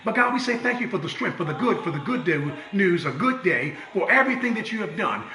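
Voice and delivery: soft, hushed prayer voice; gentle pastoral prayer; closing prayer. Acted. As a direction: pray over the congregation.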